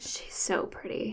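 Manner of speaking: breathy whispered aside. Real, not acted. Aside about Demi Moore.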